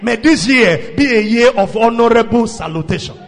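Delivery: loud, forceful pulpit declaration; booming blessing; preached prayer blessing. Real, not acted. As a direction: pray it out loudly over the congregation.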